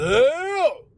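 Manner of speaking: high, loud challenging call; drawn-out hollered tough-guy hail; Stallone. Retold or imitated. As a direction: imitate a tough guy squaring up. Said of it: "where Stallone, where Dwight gets up".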